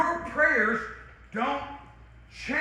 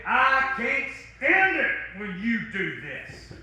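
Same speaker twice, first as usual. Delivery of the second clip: 1 blunt complaint; 5 semitones higher, 3 dB louder, slower than usual. Shown as a demonstration. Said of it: "Sometimes it's saying".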